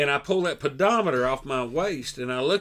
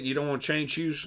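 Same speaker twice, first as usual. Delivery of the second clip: normal talking voice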